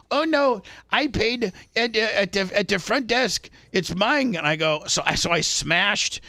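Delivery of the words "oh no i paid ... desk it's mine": higher pitched mock Indian accent, insisting; lightly imitating the Indian guy protesting. Indian customer claiming the pump in a higher mock Indian accent. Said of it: "And he's like".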